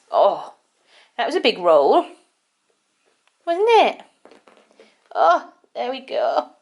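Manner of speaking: high cooing pet talk; silly cat voice. Real, not acted. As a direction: coo at the rolling cat. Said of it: "silly cat voice".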